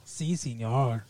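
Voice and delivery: low, soft, slow mock-Mexican accent; cartoonish Mexican accent, understated. Shown as a demonstration. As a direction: draw it out in a hokey Mexican accent. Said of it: "I can do a Mexican accent".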